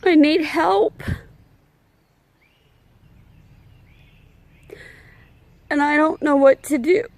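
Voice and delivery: holding back real tears while pleading for help; emotional, near tears. Real, not acted. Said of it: "I don't mean to get emotional".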